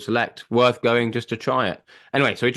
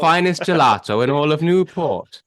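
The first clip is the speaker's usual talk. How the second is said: put-on Welsh accent; 5 semitones higher, 5 dB louder than usual. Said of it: "my terrible Welsh accent".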